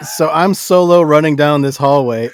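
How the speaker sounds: ordinary talk, nothing marked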